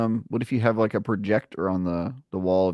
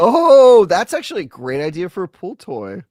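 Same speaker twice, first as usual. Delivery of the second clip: much higher, loud excited gasp; light up with a sudden idea; 8 semitones higher, 9 dB louder than usual. Real, not acted.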